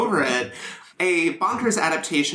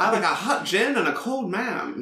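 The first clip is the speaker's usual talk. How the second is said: higher, sing-song impression of a friend; Lee; 5 semitones higher than usual. Mimicking a co-host's flirty slip, high swooping voice. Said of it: "my impression of Lee is like".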